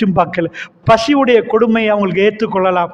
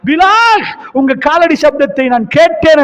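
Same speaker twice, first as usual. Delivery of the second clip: calling out; 6 semitones higher than usual. Acted. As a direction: call out to Bilal across a distance. Prophet calls Bilal.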